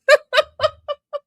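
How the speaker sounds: squealing burst of laughter; high shrieking laugh. Real, not acted. Streamer losing it over the photo with a squeal. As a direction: lose it completely.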